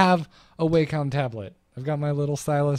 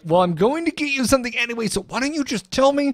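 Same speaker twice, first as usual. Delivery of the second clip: higher pleading dad voice; exasperated dad, pitched up; imitating dad; 10 semitones higher, 3 dB louder than usual. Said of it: "My, my dad is just the worst about that".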